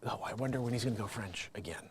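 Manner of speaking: fast whispered aside. Pretend. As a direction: whisper it like a viewer. Whispered audience voice.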